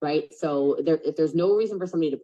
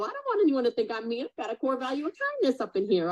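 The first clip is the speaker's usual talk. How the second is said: much higher, playful sass; sassy; 11 semitones higher than usual. Real, not acted. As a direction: get sassy and playful about it. Sassy aside after skit.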